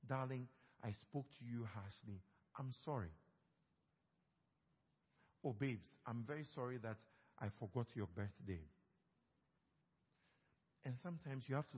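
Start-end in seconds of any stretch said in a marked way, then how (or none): 0.1-3.1 soft, tender demo of saying sorry; gentle apology. Shown as a demonstration. Pastor shows a wife how to apologize, softly. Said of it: "Learn to say it". 5.4-8.6 soft, tender demo of saying sorry; gentle apology. Shown as a demonstration. Pastor shows a wife how to apologize, softly. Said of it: "Learn to say it".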